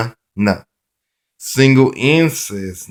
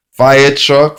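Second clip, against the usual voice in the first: called-out keyword; 5 semitones higher, 7 dB louder than usual. Shown as a demonstration. Teacher showing the phonics card, Fire truck called out loud and high. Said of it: "phonics card keyword drill".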